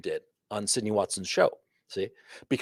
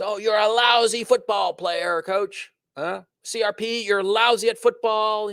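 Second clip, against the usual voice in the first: mocking taunt; 7 semitones higher, slower than usual. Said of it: "what should I do if somebody says".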